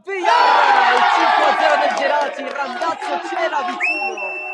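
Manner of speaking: loud, breathless goal call; breathless italian goal commentary. Real, not acted. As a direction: shout the goal at full voice. Goal scored in live commentary.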